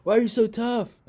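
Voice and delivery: high, loud mock fighter voice; high, loud teasing voice, playing the other fighter; imitating a friendly fighter. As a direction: ham up the friendly banter of a fighter mid-fight. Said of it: "he's just saying nice things like".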